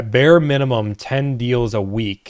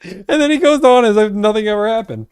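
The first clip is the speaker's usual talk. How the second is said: laughing; 11 semitones higher, 5 dB louder than usual. Real, not acted. Reacting to funny clip.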